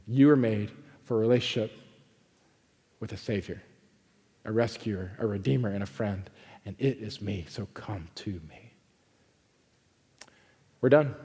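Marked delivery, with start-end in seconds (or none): 0.1-7.4 much softer and lower, intimate; tender invitation. Acted. Soft close of the sermon. 7.5-8.7 hushed invitation. Acted.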